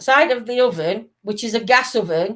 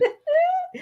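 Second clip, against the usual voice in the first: short high laugh; high giggle; 5 dB quieter than usual. Real, not acted. Laughs at shed joke.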